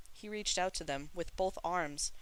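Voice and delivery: plain reading voice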